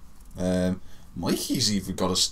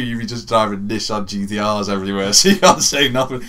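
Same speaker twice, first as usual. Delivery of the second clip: ordinary talk, nothing marked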